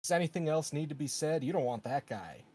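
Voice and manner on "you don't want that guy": normal talking voice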